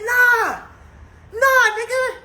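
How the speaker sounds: high, heated dare to fight; angry challenge. Retold or imitated. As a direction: get in his face and dare him. Refusing to be held back.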